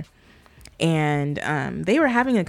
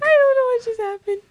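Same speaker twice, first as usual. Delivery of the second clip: high, squeaky sobbing; tearful and bewildered, voice sky-high; crying; 3 dB louder than usual. Real, not acted. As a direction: squeeze the words out through real tears. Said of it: "I'm crying".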